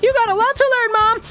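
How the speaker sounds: put-on high, sassy teen voice. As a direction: talk back like a bratty kid. Teen talking back, high.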